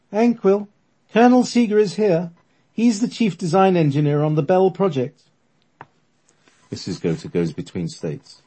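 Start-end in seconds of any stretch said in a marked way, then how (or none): 0.1-5.2 put-on old lady medium. Medium speaking.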